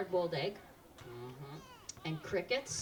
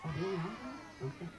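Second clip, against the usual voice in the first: ordinary talk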